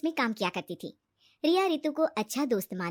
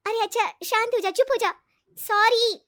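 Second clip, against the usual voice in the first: high, loud hushing of a friend; hurried soothing; 10 semitones higher, 7 dB louder than usual. Acted. Riya calming her tearful friend, urgently soothing. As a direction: placate a crying friend urgently. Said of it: "वो उसे शांत करती है".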